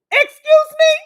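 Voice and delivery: shocked, high and loud; a sharp disbelieving "excuse me?"; incredulous. Real, not acted. Ranting vlogger, stunned by an imagined refusal, incredulous.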